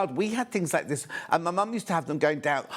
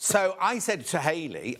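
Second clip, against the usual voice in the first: ordinary talk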